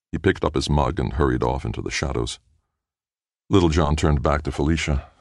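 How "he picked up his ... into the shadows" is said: ordinary delivery, nothing marked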